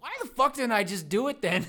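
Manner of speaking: hammed-up frustrated shout; mock outrage. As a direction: yell at yourself for missing out. Frustrated after waking.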